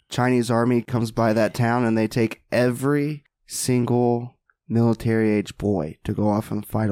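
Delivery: ordinary delivery, nothing marked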